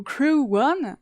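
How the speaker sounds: Crew One said the English way, slow, as kroo wun; English accent. Shown as a demonstration. To the chat, showing how to say Crew One: English accent. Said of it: "avec, euh, l'accent, euh, anglais".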